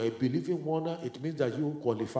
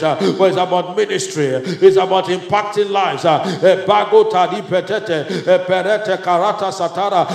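louder, driving Pentecostal prayer with bursts of tongues; loud charismatic prayer with tongues and laughter; fervent prayer in tongues; 4 semitones higher, 14 dB louder than usual. Real, not acted. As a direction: pray at full force, pressing heaven.

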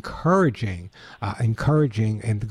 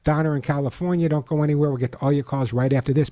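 usual speaking voice

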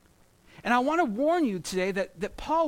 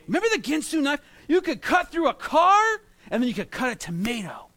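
hyped ad pitch; 6 semitones higher, 5 dB louder than usual. Retold or imitated. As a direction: sell the knife like a showman. Mimicking the Ginsu knife ad.

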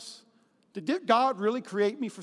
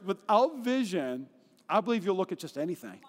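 ordinary delivery

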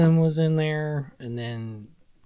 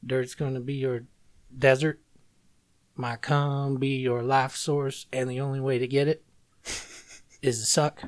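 quiet lyric recitation; 5 dB quieter than usual. Retold or imitated. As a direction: murmur the lines like a dark poem.

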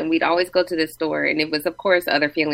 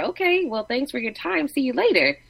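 brush-off; 7 semitones higher than usual. Retold or imitated. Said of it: "like you said earlier".

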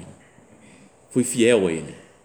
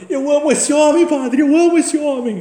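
very high, loud, gushing holler; imitated shouting; 10 dB louder than usual. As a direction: mimic an over-eager man shouting his love. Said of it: "Gritando assim".

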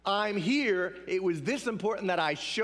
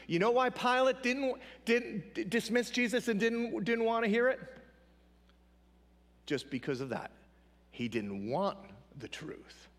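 normal talking voice

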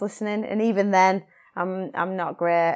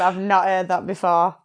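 ordinary talk

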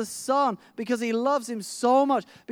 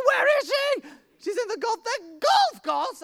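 excited; 13 semitones higher than usual. Retold or imitated. Retold exchange with the neighbour.

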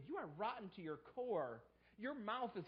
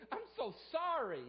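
mock apology; 10 semitones higher, 5 dB louder than usual. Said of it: "Saul doesn't say".

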